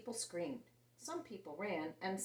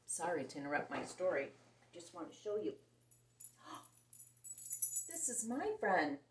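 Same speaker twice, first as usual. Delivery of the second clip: plain reading voice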